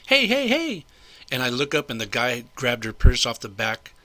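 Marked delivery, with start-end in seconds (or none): 0.1-0.9 high, held-out cries imitating an alarmed shout; retold high-pitched call of alarm; Gina calling out. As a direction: mimic someone calling after a thief, much higher. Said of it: "I just hear Gina s-, say".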